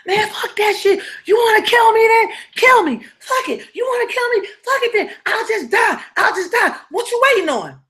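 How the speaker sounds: high, sobbing, half-shouted defiance through tears; reenacted crying plea. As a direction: cry and yell like you're daring him to kill you. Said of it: "Let me try to see if I can reenact it".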